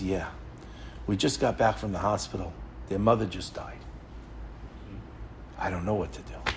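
very soft, low, halting voice; subdued grieving father. Retold or imitated. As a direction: voice a stunned widower, quiet and low. Bereaved father voiced in retold story.

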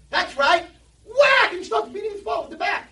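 high, loud triumphant yelling; wild shout. Retold or imitated. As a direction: yell it while swinging. Kid beating father, shouting.

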